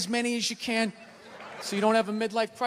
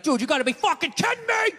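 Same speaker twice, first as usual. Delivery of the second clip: yelling at the TV; 8 semitones higher than usual. Retold or imitated. Fan yelling at the ref on TV, mock outraged shout. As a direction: shout at the ref like he blew the call. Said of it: "you're screaming at the ref on T V".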